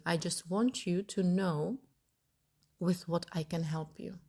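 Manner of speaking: usual speaking voice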